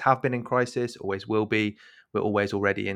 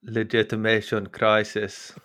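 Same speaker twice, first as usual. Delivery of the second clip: slow, put-on attempted German accent on "Legitimation Crisis" that comes out South African; a botched German accent that sounds South African; slower than usual. Shown as a demonstration. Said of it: "That's South African".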